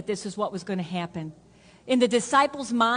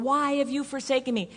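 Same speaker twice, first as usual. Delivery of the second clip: higher-pitched, voiced cry at ordinary volume; imagined Jesus on the cross; 4 semitones higher than usual. Preacher voicing how people picture Jesus on the cross, a restrained higher-pitched cry. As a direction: call the question upward, without shouting. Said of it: "we always picture Jesus going".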